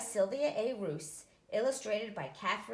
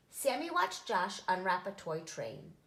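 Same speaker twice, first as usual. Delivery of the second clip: ordinary delivery, nothing marked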